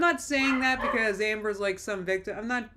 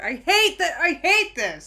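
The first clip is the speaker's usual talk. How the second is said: loud, higher frustrated yell; sudden yell; 8 semitones higher, 9 dB louder than usual. Real, not acted. Venting about Amber to viewers with a real yell. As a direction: blurt it out at full volume. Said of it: "I just yelled".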